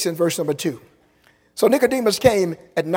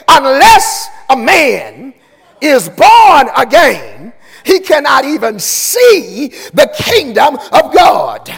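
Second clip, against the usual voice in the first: slow, louder proclamation with born pushed up; preached verse; 5 semitones higher, 8 dB louder, much slower than usual. Acted. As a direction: proclaim it to the whole church. Same verse, now preached.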